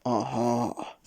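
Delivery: lightly voiced, smiling king; a knowing royal aha; goblin king. A narrator's reading. King greeting Mister Uppity, lightly voiced and smiling. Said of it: "smiled the king".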